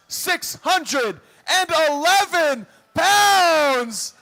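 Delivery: loud arena announcer shouting; hype announcer. Acted. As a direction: get the crowd on its feet. Announces Alex, crowd on its feet.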